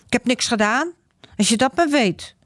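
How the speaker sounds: grumbling. Acted. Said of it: "moppert Joran".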